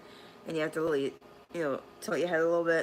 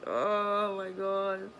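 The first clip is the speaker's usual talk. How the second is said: drawn-out amazement; 3 semitones higher, much slower than usual. Retold or imitated. Retold weird feeling.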